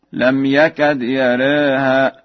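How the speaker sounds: slow chant, "يراها" said yarèha; taqlil. Shown as a demonstration. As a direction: tilt the long a after the ra of "يراها" toward e. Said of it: "التقليل في ذوات الياء والراء". (ترتيل مع تقليل "يراها")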